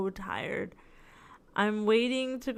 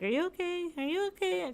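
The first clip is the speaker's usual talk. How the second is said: much higher, lightly voiced worried coworker; concerned coworkers; 10 semitones higher, 3 dB quieter than usual. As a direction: mimic the worried coworkers up high. Coworkers quoted asking.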